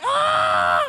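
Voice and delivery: sudden high shriek of panic; high panicked yelp. Retold or imitated. Caught out, he lets out a high panicked yelp. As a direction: yelp in horror. Caught saying something awful.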